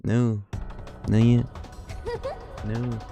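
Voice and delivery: normal talking voice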